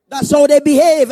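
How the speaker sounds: usual speaking voice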